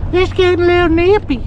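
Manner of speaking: high, whiny mimic of Haley; mocking imitation. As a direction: mimic her complaint in a high voice. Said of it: "She's over here".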